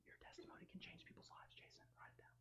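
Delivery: hushed, unvoiced whisper; whispered repeat. Real, not acted. Speaker prompting a listener to write it down, whispered. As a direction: whisper it as a private aside. Aside repeating key line to Jason.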